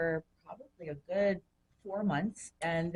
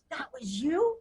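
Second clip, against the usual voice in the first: retold surprise; 8 semitones higher, 3 dB louder, slower than usual. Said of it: "And he goes".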